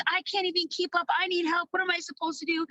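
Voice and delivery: high, fast mock panic; mock overwhelmed member. Vlogger imitating overwhelmed members messaging uplines, high and fast. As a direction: voice a swamped new seller begging for help. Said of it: "all gonna be messaging your uplines".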